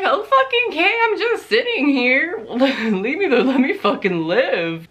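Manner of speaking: high, loud, incredulous squeal of disbelief; exasperated retort. Retold or imitated. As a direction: shriek it like you can't believe your ears. Exasperated retort to a homophobic remark.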